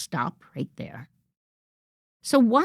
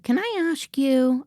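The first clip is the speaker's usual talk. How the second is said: higher, tentative "Can I ask you?"; timid asking; 7 semitones higher than usual. Shown as a demonstration. Coach mimics a meek employee asking permission, tentative. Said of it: "The sentence that starts with".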